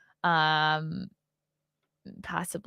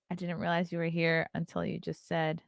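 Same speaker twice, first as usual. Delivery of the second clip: ordinary talk, nothing marked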